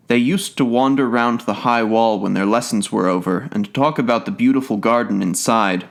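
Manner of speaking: ordinary delivery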